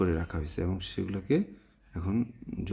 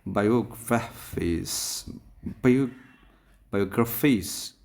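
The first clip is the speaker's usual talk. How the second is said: Biographies drawn out, weight on graph; stress on graph; slower than usual. Shown as a demonstration. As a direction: punch graph inside biographies. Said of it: "গ্রাফের পরে আমরা উচ্চারণ দেব".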